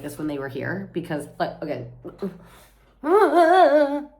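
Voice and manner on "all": loud, high burst; worked-up outburst. Real, not acted. Vlogger wound up about being sick, loud high burst. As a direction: blurt it out, all wound up. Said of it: "I need to calm down".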